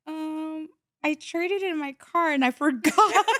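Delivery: high, small voice breaking into laughter; retold sheepish confession. Confessing the traded car to patrol, retold sheepishness. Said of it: "I was like".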